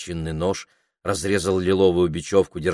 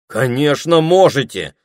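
a touch of offended protest; a boy mildly indignant at the doubt; 7 semitones higher, 7 dB louder than usual. Acted. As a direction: answer as if insulted. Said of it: "с негодованием отозвался Саймон".

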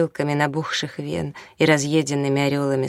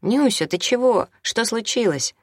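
higher-pitched concerned call; 7 semitones higher than usual. A narrator's reading. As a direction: call out to her with a question. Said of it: "окликнул он её".

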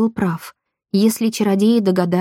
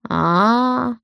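drawn-out, higher, sneering "ah"; knowing, taunting drawl; mocking drawl; 4 semitones higher than usual. A narrator's reading. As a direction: stretch it out in mockery. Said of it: "с издёвкой протянул чародей".

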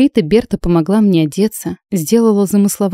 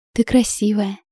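put-on timid little girl; 3 semitones higher than usual. A narrator's reading. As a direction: say it shyly with a tentative smile. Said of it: "несмело улыбнувшись". (робко, детским голоском)

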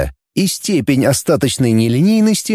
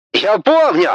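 angry; 8 semitones higher than usual. Acted. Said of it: "сердито отозвался Таппи".

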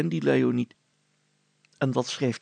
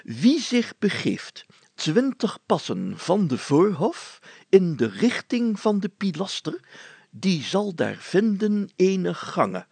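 higher and slow, like an ancient proclamation; slow, raised ceremonial reading voice; solemn old-fashioned reading; 6 semitones higher, slower than usual. Acted. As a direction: read each word of the old clue slowly and gravely, pitched up. Reading old clue text aloud.

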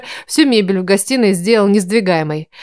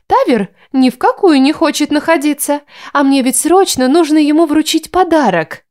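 put-on lively young woman; 7 semitones higher than usual. A narrator's reading. Darla's voice.

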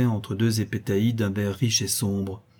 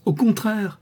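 high gleeful shout; much higher, cheeky giggling cry; laughing cry; 13 semitones higher, 4 dB louder, slower than usual. Acted. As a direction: toss it out mid-laugh. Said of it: "cria Lissa en éclatant de rire".

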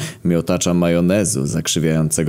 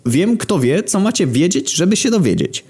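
eager repetition; 13 semitones higher than usual. Acted. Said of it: "No, mówię".

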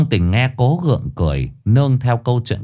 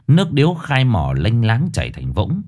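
ordinary delivery

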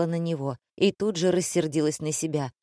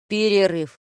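louder one-word order; fed-up announcement; 3 semitones higher, 5 dB louder than usual. A narrator's reading. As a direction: call a break with a raised voice. Fed-up director dismisses everyone. (громко объявить перерыв)

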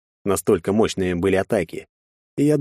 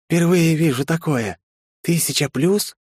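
slow, high amazed question; astonished; 9 semitones higher than usual. A narrator's reading. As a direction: marvel at it, rising. Lizard girl amazed.

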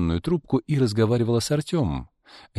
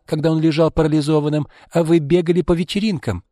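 high, loud reproach; aggrieved protest; 9 semitones higher, 4 dB louder than usual. A narrator's reading. Izolda reproaches Arkady over what she deserves, aggrieved. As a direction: list your sacrifices indignantly, pitched high. Acted quarrel over inheritance.

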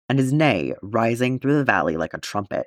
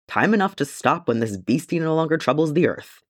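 retorted; 6 semitones higher than usual. A narrator's reading. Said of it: "Gimli retorted".

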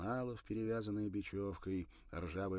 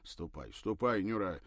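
irritated grumble; 2 semitones higher, 8 dB louder than usual. A narrator's reading. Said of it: "раздражённо пробурчал он".

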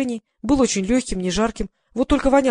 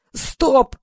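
higher-pitched narrated yell; raised-voice director's order; yelled command; 5 semitones higher, 4 dB louder than usual. A narrator's reading. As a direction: call an abrupt halt to the action. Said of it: "заорал Гриша".

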